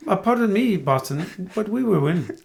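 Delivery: put-on Giannis-style Greek accent. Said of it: "in his nice Greek accent".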